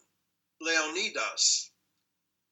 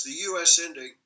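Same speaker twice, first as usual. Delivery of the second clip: ordinary delivery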